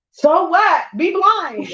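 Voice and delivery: high-pitched imitation of an angry partner; exasperated mock outburst; mock freak-out. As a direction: snap it high, hammed-up. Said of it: "he's just freaking out".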